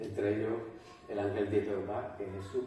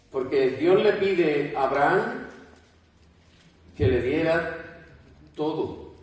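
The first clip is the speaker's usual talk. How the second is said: louder, rising preacher's voice; full-force preaching; 4 semitones higher, 9 dB louder than usual. Acted. Preacher explains loving God above family, full-force preaching. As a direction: preach it out to the congregation.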